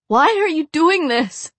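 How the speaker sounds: high, loud anguished woman's plea; desperate, almost sobbing. A narrator's reading. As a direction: plead desperately, on the edge of sobs. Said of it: "in a desperate, almost sobbing voice".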